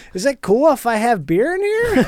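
a lightly voiced out-of-work welder from Wisconsin.

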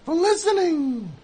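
usual speaking voice

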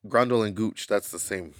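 usual speaking voice

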